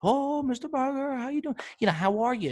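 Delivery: gushing shop owner. Retold or imitated. Liquor store owner greeting a regular, gushing and high. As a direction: gush like he sees you daily. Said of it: "he acts like he sees me every single day".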